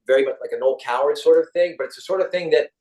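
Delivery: ordinary delivery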